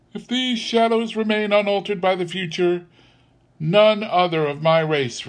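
high, unrelenting spirit voice; stern ghost. A narrator's reading. Ghost answers Scrooge's plea, stern. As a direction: pronounce the verdict from on high.

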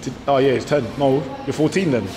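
ordinary talk

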